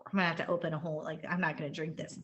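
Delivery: soft, fast grumble; muttering in disgust; quiet disgusted aside. Retold or imitated. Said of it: "I was a little like".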